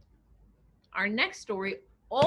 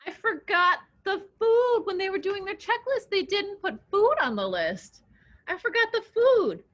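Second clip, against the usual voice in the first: dismayed; 8 semitones higher, 3 dB louder than usual. Acted. Mouse upset, forgot food.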